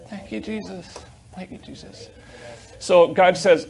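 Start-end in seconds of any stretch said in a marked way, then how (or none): 0.1-1.0 hushed thanks. Real, not acted. Hushed praise after testimony. 1.3-2.1 fainter hushed thanks. Real, not acted. Hushed praise after testimony.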